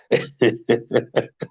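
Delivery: amused rippling giggle; real laughter. Real, not acted. Imagining the dress at another's wedding, chuckling. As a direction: chuckle at your own joke.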